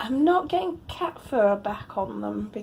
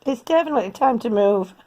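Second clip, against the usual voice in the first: normal talking voice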